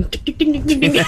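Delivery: high, repeated mock dinging; ding like an insistent call button; playful high chime imitation; imitated call-button chime. Imitates flight attendant call button.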